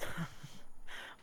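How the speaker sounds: short breathy chuckle. Acted. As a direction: chuckle in character before speaking. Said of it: "He chuckles and says".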